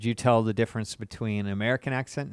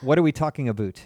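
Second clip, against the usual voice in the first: quick mock Canadian accent; 2 semitones higher, 4 dB louder, faster than usual. Shown as a demonstration. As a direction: say ABOUT as "aboot", Canadian style. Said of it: "That's Canadian".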